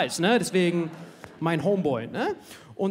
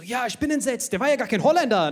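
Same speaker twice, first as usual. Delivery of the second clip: mock shocked kid; 6 semitones higher, 6 dB louder than usual. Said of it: "Ich so".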